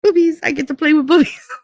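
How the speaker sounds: put-on high, giddy little-kid chant; gleeful inner voice. Drunk teacher thinking about boobs while acting clinical, gleeful. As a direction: celebrate privately in a high, delighted squeal. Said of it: "in my head, I'm going".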